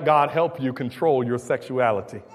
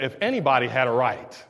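usual speaking voice

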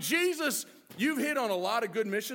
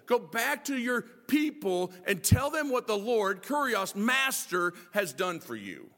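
usual speaking voice

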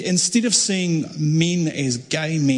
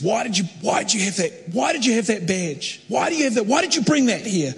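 upset questioning; 6 semitones higher than usual. Retold or imitated. Crying man questions the speaker.

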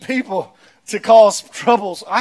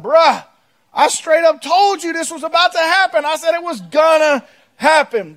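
lightly acted exasperated reply, pitched up; Jesus; 9 semitones higher, 5 dB louder than usual. Answering a whining believer, exasperated I-told-you-so. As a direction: push back in high, emphatic disbelief. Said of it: "Jesus looks at me, he's like".

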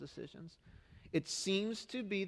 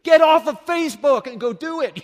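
much higher, much louder blast of frustration; pent-up shout; 12 semitones higher, 19 dB louder than usual. Acted. Pastor venting at complaining Facebook posters, shouting. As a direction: let it all out at them, full volume. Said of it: "that's what I wanna say".

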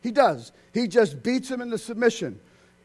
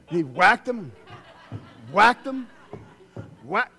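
mock whacking; 6 dB louder, slower than usual. Acting out the blows.

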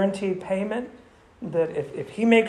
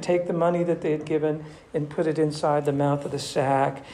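ordinary talk

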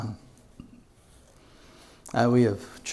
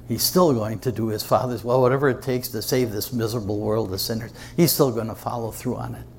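ordinary delivery

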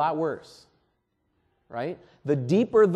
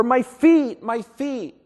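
a higher, louder mock cry of pain that fades on the repeat; 7 semitones higher, 5 dB louder, slower than usual. Pastor imagining Jesus crying about his feet, pained. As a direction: cry out over nailed feet. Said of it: "he didn't cry out".

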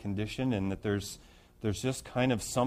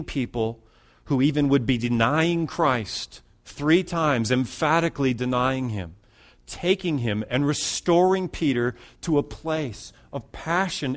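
raised, higher, louder preaching voice; fervent preaching; 3 semitones higher, 5 dB louder than usual. Acted. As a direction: build it up and proclaim the hope. Preaching climax on restoration.

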